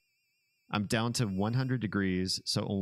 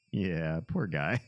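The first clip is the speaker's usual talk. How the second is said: ordinary talk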